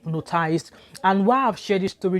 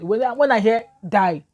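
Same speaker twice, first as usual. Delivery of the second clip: sudden alarmed cry; panicked outburst; 5 semitones higher, 6 dB louder than usual. Retold or imitated. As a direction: voice jumps up loud on "die". Recalling panic at death threat.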